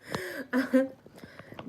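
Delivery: high bursting laugh. Real, not acted. Comedian laughing after the store lady story. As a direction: laugh out at your own story.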